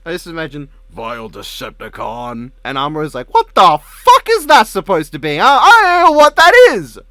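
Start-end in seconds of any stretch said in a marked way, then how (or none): 0.9-2.5 low, slow heroic robot voice; Optimus Prime impression. Acting Optimus Prime beating someone. 3.3-7.0 high, loud incredulous yell; baffled outburst. Acted. Acting Amuro baffled by Decepticon insult.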